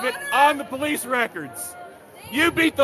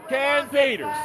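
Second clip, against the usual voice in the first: normal talking voice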